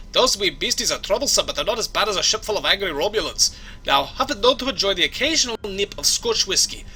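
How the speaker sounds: hammed-up Scottish brogue; Scottish accent. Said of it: "my Scottish accent needs some work".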